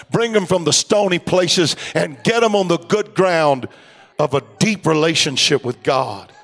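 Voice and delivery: preacher's prayer. Acted. Prayer in sermon.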